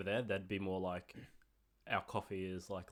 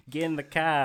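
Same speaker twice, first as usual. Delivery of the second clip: hammed-up Boston accent, much higher and louder, car without r; 7 semitones higher, 13 dB louder than usual. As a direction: bark it like a Boston tough guy. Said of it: "I don't know if that's right".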